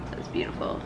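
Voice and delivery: breathy whisper of wonder; awed whisper. Real, not acted. Showing the eyeshadow swatch in an awed whisper. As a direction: whisper it in awe.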